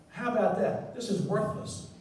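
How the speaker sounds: ordinary talk, nothing marked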